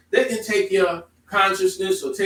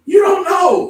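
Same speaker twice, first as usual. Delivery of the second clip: shouted, much higher and louder; sudden shout; 11 semitones higher, 12 dB louder, slower than usual. Real, not acted. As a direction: burst out on it. Preacher's emphatic outburst.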